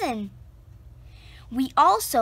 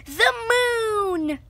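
big high lift on the moon; excited; 9 semitones higher than usual. A narrator's reading. Narrator reveals the plan with high excitement. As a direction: reveal it like a grand discovery.

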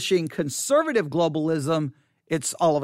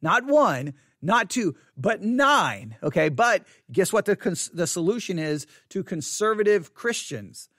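normal talking voice

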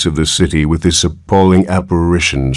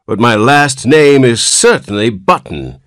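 put-on higher whiny old man; querulous whine; 8 semitones higher, 4 dB louder than usual. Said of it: "replied the querulous whine".

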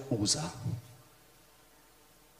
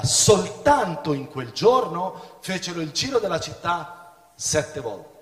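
raised, solemn Bible reading voice; scripture reading; 4 semitones higher, 4 dB louder than usual. Acted. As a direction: read the verse out to the congregation. Preacher reads scripture aloud.

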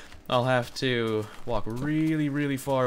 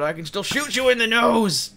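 high, loud fight cries; excited battle yell; 12 semitones higher, 8 dB louder, slower than usual. Real, not acted. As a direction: shout it mid-fight. Fighting a mob, shouting.

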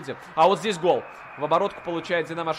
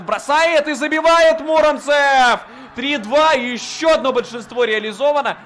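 loud high shout of the scorer's name; excited hockey goal call; 8 semitones higher, 8 dB louder than usual. Real, not acted. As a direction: yell the goal. (громкий крик «забивает»)

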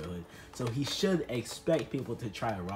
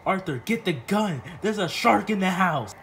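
loud, higher panicked order; alarmed call; 5 semitones higher, 5 dB louder than usual. Acted. Rich man calling his butler about the shark, alarmed shout.

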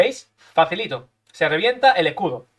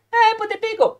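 very high squeaky taunt; high cartoonish mockery; mock opponent voice; 13 semitones higher than usual. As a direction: mimic the rival in a silly high voice. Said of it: "voicing the opponent".

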